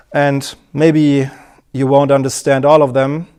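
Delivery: loud, tight, high-pitched boasting voice; stiff overconfident speaker. Acted. Coach mimics a rigid presenter in a suit: loud, tight, overconfident. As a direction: play a stiff man in a suit covering his nerves. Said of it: "very tight, very strong voice, very confident".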